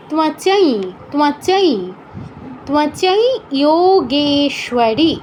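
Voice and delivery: "তোমার চাই" ending in a held, nasalized ই; nasal i demo. Shown as a demonstration. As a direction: draw out the nasal ই in "চাই" before slow "Jogeshwari". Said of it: "ই চন্দ্রবিন্দুর মতো হবে". ("চাই"-এর ই চন্দ্রবিন্দুর মতো নাসিক্য, টেনে)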